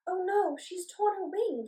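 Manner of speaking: high, alarmed gasp, slowing; fairy finds her bee's torn wing, gasping. Acted. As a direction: gasp in worry over the bee. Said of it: "she gasped".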